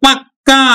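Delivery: পাক্কা with the ক held long and doubled. Shown as a demonstration. Teacher demonstrating পাকা turning into পাক্কা for students. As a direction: hit the double ক in পাক্কা hard. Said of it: "পাকা থেকে যখন আমরা দিব পাক্কা".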